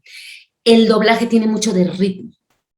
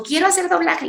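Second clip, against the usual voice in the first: imitating young man; 10 semitones higher than usual. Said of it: "me dijo".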